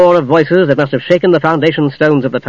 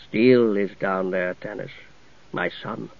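solemn ghost knight; 6 semitones lower, 10 dB quieter than usual. Acted. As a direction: speak like a grave spirit.

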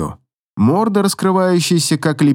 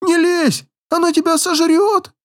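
much higher, wailing plea; mournful wail; 13 semitones higher than usual. Acted. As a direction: wail after her in despair. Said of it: "грустный вопль".

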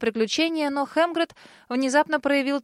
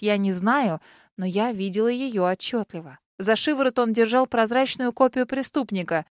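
plain reading voice

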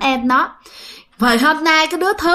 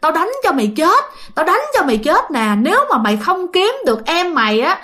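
higher-pitched acted father's tirade; storyteller's mock cursing, pitched up without shouting; angry scolding; 4 semitones higher than usual. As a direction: berate the children as an enraged father. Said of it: "vừa đánh vừa chửi".

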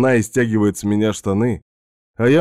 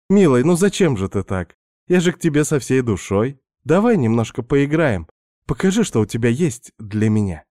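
Svetka's voice; 3 semitones higher than usual. Acted. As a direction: play the pushy houseguest luring him in. Narrator voices seductive Svetka.

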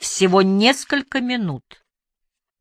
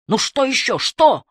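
furious chef; 6 semitones higher than usual. A narrator's reading. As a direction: snap it out in irritation. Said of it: "рявкнула Эмили".

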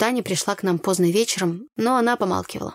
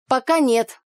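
higher start on ПОКА, then falling; vague; 5 semitones higher, 4 dB louder, slower than usual. A narrator's reading. Igor dodges the narrator's question, vague. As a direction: answer «Пока нет» evasively, pitched up. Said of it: "туманно отозвался парень".

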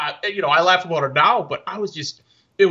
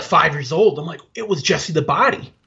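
ordinary talk, nothing marked

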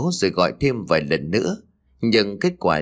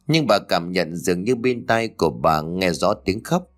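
ordinary delivery, nothing marked

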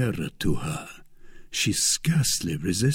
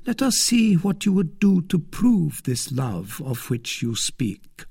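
high-pitched lady's voice, probing; a scheming lady putting her suitor to the test; Milady, coolly testing; 8 semitones higher than usual. A narrator's reading. Lady demanding proof of love from suitor, high and testing.

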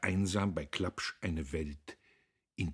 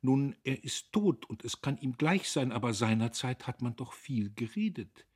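higher-pitched nervous prattle; a touch of fear, pitched up; anxious chattering; 7 semitones higher, 4 dB louder than usual. A narrator's reading. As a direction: babble on uneasily under his stare. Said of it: "schwatzte sie angstvoll weiter".

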